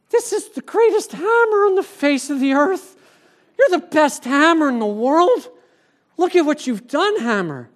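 high-pitched, hammed-up adoration; mock gushing praise. Imagining praising the hammer that built the pulpit, gushing in mock awe. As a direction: pretend to fawn over it, loud and high.

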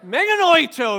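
Meganoito shouted high and loud; yelled out. Shown as a demonstration. Preacher shows the church what to yell, loud shout. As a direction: yell Meganoito back at a critic. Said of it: "I want you to yell out".

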